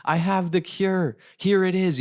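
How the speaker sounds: high, drawn-out announcement of a cure; thrilled, high-pitched offer to help; eager proclamation. Acted. Acts out evangelical offering of a cure.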